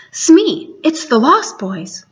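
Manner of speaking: ordinary delivery, nothing marked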